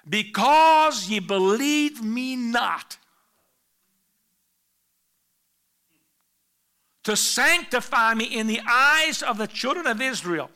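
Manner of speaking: slow, loud, solemn divine voice; voice of God. Acted. The Lord sentencing Moses and Aaron, solemn. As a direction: pronounce judgment from on high.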